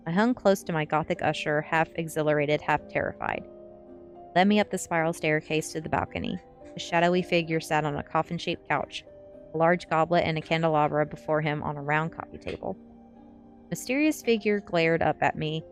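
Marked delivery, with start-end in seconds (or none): none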